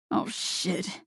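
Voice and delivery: under-the-breath oh shit; muttered curse. A narrator's reading. Man realizing the cake was special, swearing under his breath. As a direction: catch yourself swearing.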